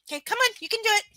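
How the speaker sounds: very high, loud coaxing pet voice; sing-song encouragement to a puppy. Real, not acted. Coaxing dog again.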